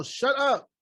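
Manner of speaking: put-on high, loud "shut up" at chattering kids; exasperated outburst, played for laughs; mock yell. Acted yell at talking kids.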